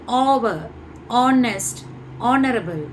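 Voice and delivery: "ஆவர், ஆனஸ்ட், ஆனரபிள்" each starting on an open "aw"; silent h. Shown as a demonstration. Teacher modelling how to pronounce these, vowel-first words. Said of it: "இப்படி தான் நம்ம pronounce பண்ணணும்".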